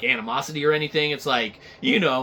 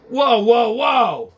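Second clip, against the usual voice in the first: mock shocked whoa; 6 semitones higher, 7 dB louder, slower than usual. Host recoils loudly.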